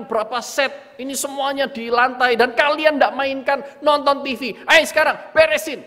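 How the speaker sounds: put-on loud, high scolding of the kids; angry father yelling. Father scolding his children about toys left on the floor, furious yell. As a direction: shout at the kids over the messy toys. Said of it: "Langsung keluar amarah itu dan berkata".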